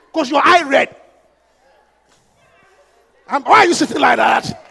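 usual speaking voice

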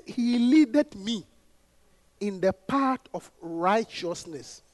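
slow, drawn-out recitation of the psalm; solemn slow quotation; slow scripture quote. Shown as a demonstration. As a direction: draw each word out like a reading. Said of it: "quoting scripture slowly".

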